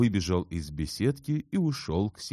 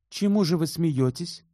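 high, louder challenging question; cold, almost sharp; 12 semitones higher, 5 dB louder than usual. A narrator's reading. Elena challenges Shubin for laughing, cold and almost sharp. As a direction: demand it coolly and sharply. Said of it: "спросила она холодно и почти резко".

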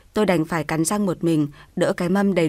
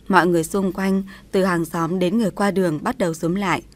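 plain reading voice

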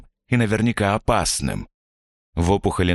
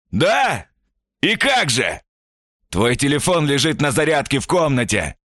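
mocking shout; 9 semitones higher than usual. Stranger mocks through the door.

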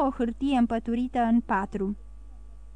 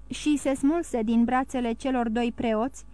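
ordinary delivery

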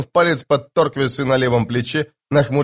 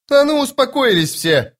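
sharp barked command, pitched up; barked order; 11 semitones higher than usual. A narrator's reading. Sergeant barking at squabbling soldiers, sharp command. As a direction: bark at them to calm down. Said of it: "рявкнула она".

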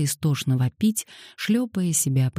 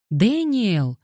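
mildly raised call after him; 7 semitones higher than usual. Acted. Little girl calling after Daniel, mildly raised. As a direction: call to the boy walking away. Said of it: "окликнула она".

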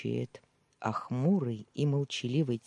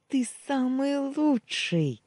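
much higher, slow, thrilled squeal; squealing with joy; 12 semitones higher, 4 dB louder, slower than usual. Acted. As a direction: squeal it out as you hug him. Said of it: "заверещала она".

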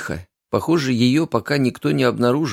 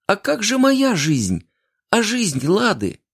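much higher, slow, cracking cry; voice breaking; 11 semitones higher, slower than usual. A narrator's reading. Hurt girl confronting her lover, breaking voice. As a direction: let the voice break as you plead. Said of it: "воскликнула девушка срывающимся голосом".

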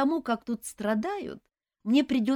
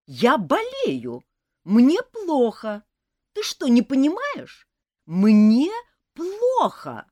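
much louder, slow, high protest; loud indignant whine; 5 semitones higher, 10 dB louder, slower than usual. A narrator's reading. Suddenly revived elf yells at nurse, loud whine. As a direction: demand pity at full volume. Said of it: "внезапно окрепнув и воспрянув духом".

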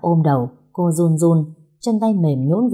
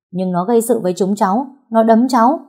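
put-on boys' higher, louder protest at the end; speaking up loudly; 6 semitones higher, 4 dB louder than usual. A narrator's reading. Boys defending themselves to an adult over a fight, speaking up loudly. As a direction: protest like an accused child, rising. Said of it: "Mấy thằng con trai nói to".

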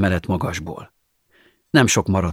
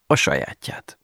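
whispered, lower punchline; whispered aside; 6 semitones lower, 3 dB quieter than usual. Acted. Narrator to listener, sly punchline: whispered. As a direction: drop to a whisper for the twist.

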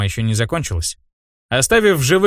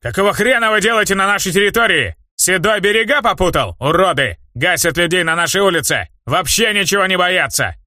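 higher, furious bandit yelling; angry; 5 semitones higher than usual. Acted. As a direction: rage at the intruders. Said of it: "старший рассвирепел".

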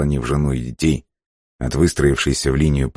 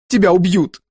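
very high, desperate outcry; cried out; 4 dB louder than usual. A narrator's reading. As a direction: cry it out right in their face. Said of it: "выкрикнула Бланка ему в лицо". (отчаянный выкрик)

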